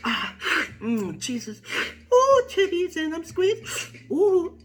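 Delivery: high-pitched comic moaning; mock groans rising into a squeal; Luke. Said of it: "then have Luke going".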